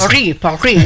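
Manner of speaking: PARIS said twice the French way, silent final S; French pronunciation. Shown as a demonstration. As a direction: show off a careful French Paris, stressed on the last syllable. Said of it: "ci tengo io a pronunciare bene il francese".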